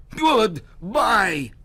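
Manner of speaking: loud high parting yell; goblin shout. Acted. Goblin yells goodbye as he flies off, loud. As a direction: fling it back as you leave.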